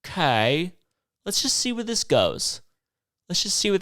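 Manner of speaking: mock carefree girlfriend; ex's voice. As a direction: shrug it off lightly. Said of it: "was like".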